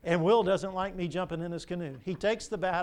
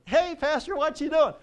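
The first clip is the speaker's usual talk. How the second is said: Andrew calling out; 9 semitones higher, 4 dB louder than usual. Acted. Andrew voiced in sermon illustration.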